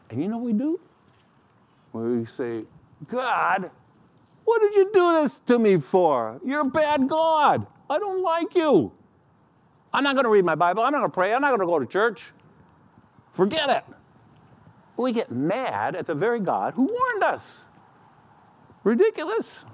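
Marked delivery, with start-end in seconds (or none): 3.1-13.8 high, loud, sulking tirade at God; a petulant believer shouting at heaven; angry complaint to God. Retold or imitated. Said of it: "We complain".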